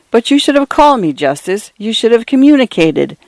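high-pitched, urgent reproach; reproachful. A narrator's reading. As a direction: scold him for keeping you uninformed. Brother scolds uncle for not calling.

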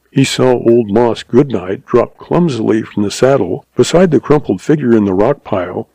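plain reading voice

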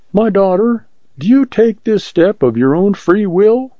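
put-on high-pitched, timid old minister; trembling voice. A narrator's reading. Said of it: "in a trembling voice".

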